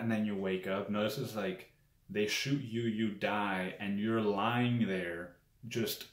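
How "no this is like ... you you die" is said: ordinary delivery, nothing marked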